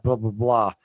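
usual speaking voice

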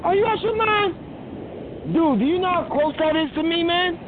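high-pitched disbelief, voice shooting way up; are you out your mind, pitched high in shock; incredulous. Real, not acted. Host to caller shopping nearby, high incredulous disbelief.

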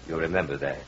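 usual speaking voice

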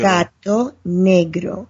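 Gato negro slowly, GAH-toh NEH-gro with tapped r; slow Spanish pronunciation. Shown as a demonstration. Teaching the wine name, saying Gato negro slowly in Spanish. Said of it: "a Spanish lesson".

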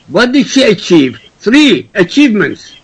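usual speaking voice

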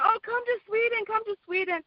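high, sing-song mock of a smooth talker luring her over; coaxing Frederick. Woman mimicking Frederick inviting her to Sweden, high coaxing voice. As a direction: put on a sweet, wheedling sales voice. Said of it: "he's going".

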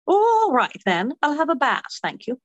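bright British pub lady; high-pitched Cockney barmaid voice; British accent. Acted. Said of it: "I've been British all week".

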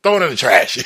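loud, brusque brush-off; quick dismissal. Acted. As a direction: toss it off as not worth keeping. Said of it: "you quick to just say".

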